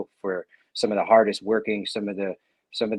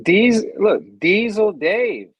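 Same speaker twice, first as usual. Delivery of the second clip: loud drawn-out name call; 11 semitones higher, 9 dB louder, much slower than usual. Real, not acted. Announcing his own nickname.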